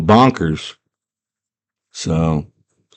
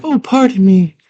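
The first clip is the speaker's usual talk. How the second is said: high-pitched mimicry of a girl; girl's voice; 12 semitones higher than usual. Sarah politely addressing the little guy, high girl voice. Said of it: "And she's like".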